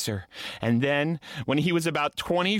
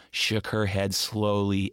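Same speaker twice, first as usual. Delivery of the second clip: usual speaking voice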